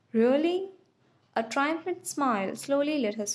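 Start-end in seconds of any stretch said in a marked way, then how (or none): 0.1-0.7 pleased, lifted question. A narrator's reading. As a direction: sound happily surprised. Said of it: "he sounded pleased".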